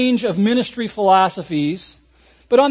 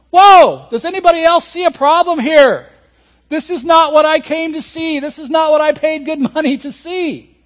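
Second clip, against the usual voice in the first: higher and louder outraged protest; a fan demanding his money's worth; exasperated fan; 6 semitones higher, 5 dB louder than usual. Retold or imitated.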